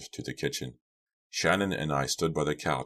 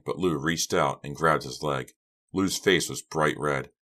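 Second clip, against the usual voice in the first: plain reading voice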